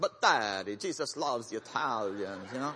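put-on Italian accent. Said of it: "he'd put on this Italian accent".

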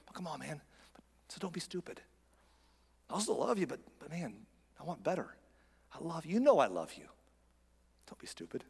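hushed, intimate appeal; God's tender plea. Acted. Pastor as God pleading with a wayward child, softly. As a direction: drop to a soft, coaxing near-whisper. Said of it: "hear him saying".

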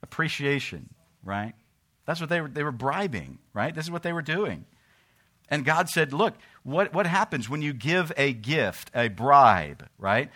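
fiery sermon voice; preaching at full force. Acted. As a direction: thunder it from the pulpit.

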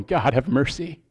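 quiet, breaking, tearful prayer; hushed grieving cry to God; tearful plea. Retold or imitated. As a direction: plead softly as if near tears. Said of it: "I'm weeping and crying".

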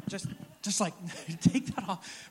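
strained, halting words with a laugh; mock struggle. Preacher acts out escaping an awkward hug, mock struggle. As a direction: act out prying off a clinger, half laughing. Acting out peeling off clinging arms.

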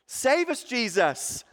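high, eager crowd plea; voiced excited crowd. Crowd crying to Jesus, high and eager. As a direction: beg like a crowd that found its king. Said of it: "they're like".

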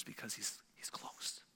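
soft unvoiced whisper. Shown as a demonstration. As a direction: hush it as if right beside them. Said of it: "God whispers".

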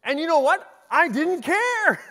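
loud, high-pitched proclamation; exuberant outburst. Acted. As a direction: belt it out high, stretching the last words. Gleeful confession about son's choice.

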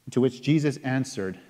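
ordinary talk

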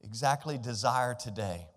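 normal talking voice

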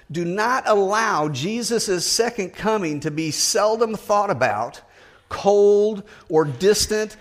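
impassioned preacher pleading; fervent preaching. Acted. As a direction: preach it hard, urging them. Preaching with rising force.

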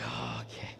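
whispered, low reluctant agreement; meek giving in. Retold or imitated. Husband finally gives in to his wife, meek whisper. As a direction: give in under your breath.

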